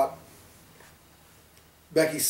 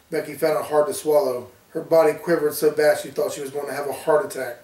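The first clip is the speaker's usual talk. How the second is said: usual speaking voice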